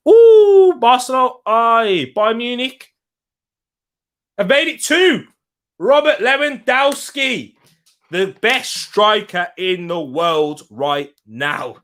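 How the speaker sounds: high-pitched, loud burst of excitement; real football-fan excitement, pitched high; excited goal reaction. Real, not acted. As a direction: hail the goal, drawing out the striker's praise. Reacting to live goals.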